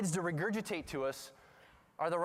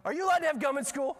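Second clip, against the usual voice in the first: high, loud, fast interrogation; imitated scolding teacher; 9 semitones higher, 5 dB louder, faster than usual. Teacher questioning girl about gum, loud rapid mock scolding. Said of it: "the teacher's like".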